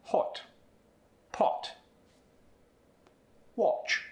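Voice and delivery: short rounded British vowel in hot, pot, watch; clipped British O sound; British accent. Shown as a demonstration. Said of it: "are gonna sound like this".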